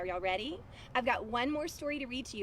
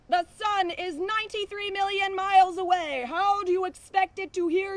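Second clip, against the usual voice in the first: bull voice; 9 semitones higher, 10 dB louder than usual. Acted. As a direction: boom it out like a big farm bull. Bull keeps talking.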